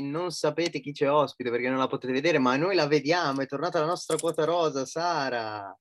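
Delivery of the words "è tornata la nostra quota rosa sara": normal talking voice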